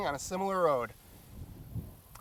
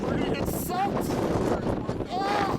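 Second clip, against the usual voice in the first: shouting over wind; slower than usual. Real, not acted. Caught in storm, hail and wind.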